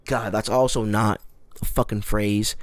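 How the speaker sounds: ordinary talk, nothing marked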